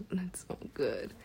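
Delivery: soft unvoiced whisper; whispered aside. Real, not acted. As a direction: breathe it out quietly.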